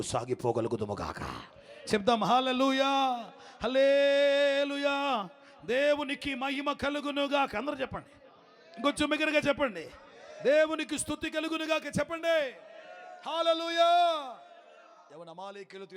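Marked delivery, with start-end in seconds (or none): 1.9-13.9 preaching call. Acted. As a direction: whip up the congregation with hallelujahs. Preacher rousing congregation.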